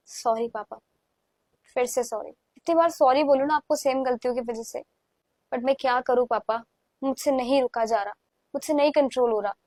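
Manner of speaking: soft, low, subdued confession; helpless apology. Acted. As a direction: plead quietly, worn down by guilt.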